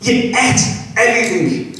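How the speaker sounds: ordinary delivery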